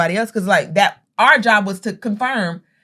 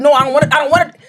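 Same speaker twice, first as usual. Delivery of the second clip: louder and higher, insisting no; raised arguing voice; 7 semitones higher, 7 dB louder, faster than usual. Retold or imitated. Sister arguing with brother over stamping, loud insistent. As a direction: push back hard like a sibling squabble.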